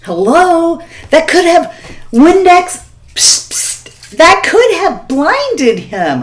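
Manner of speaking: high, loud, disbelieving cry; shocked at what she did, voice shooting up; incredulous exclaim. Real, not acted. Aghast at her own prank.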